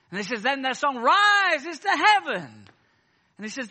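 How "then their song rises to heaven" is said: high, loud, slow revivalist cry; drawn-out, soaring mock pulpit shout; imitating American preacher. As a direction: mimic an Afro-American preacher lifting the roof. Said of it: "an Afro-American preacher".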